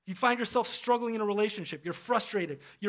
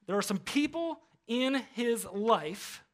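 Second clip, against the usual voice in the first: normal talking voice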